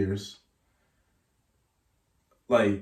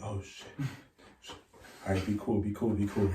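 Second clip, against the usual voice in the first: hushed self-calming; 9 dB quieter than usual. Retold or imitated. Player seeing the coach's number ring, hushed self-calming. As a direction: talk yourself down under your breath. Steadying himself as the phone rings.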